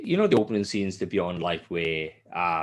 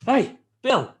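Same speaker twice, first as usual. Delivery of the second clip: higher, called-out request; calling out to a worker; 10 semitones higher than usual. Retold or imitated. Safety guy calling to Bill for handrails, mock calling out. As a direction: shout over to the builder. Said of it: "You have to".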